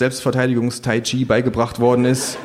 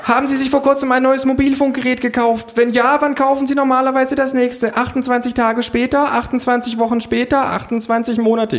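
very high-pitched survey questioning; shrill put-on interviewer; relentless pollster; 3 dB louder than usual. A narrator's reading. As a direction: pelt them with questions in a high voice. Pollster questions while chasing.

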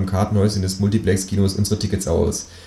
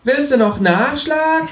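much higher, slow cocky taunt; kangaroo taunt; slower than usual. Retold or imitated. Kangaroo taunting the knocked-down thug, higher and slower. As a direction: tease him slowly and smugly. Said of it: "Fragt das Känguru".

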